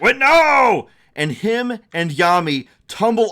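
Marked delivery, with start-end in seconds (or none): 0.0-0.9 high, loud cry of alarm; panicked shout. Acted. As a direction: yell as you lose your footing. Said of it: "He's like".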